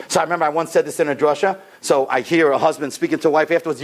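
normal talking voice

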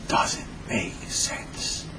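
very slow, hushed self-talk; baffled whisper. Acted. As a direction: whisper it slowly, puzzling it out. Son muses to himself.

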